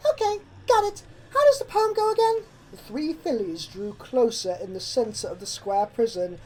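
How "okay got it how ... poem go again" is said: high, loud, fast panicked rush; panicking. Acted. Sweetie Belle panics and demands the rest of the poem, fast. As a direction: babble out of rising panic. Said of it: "as she began to panic".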